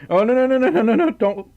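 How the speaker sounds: real sudden alarm, high and loud. Real, not acted. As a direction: blurt it out to stop a mishap. Straw about to fall in.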